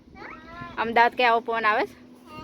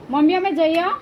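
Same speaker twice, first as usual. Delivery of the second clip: calling out; 8 semitones higher, 8 dB louder than usual. Real, not acted. Calling goodbye to mom from afar.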